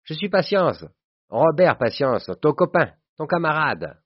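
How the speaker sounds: put-on high-pitched, exuberant old school friend; jovial greeting. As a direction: announce yourself joyfully to an old pal. Old friend jovially introduces himself. (retrouvailles exubérantes, voix haut perchée)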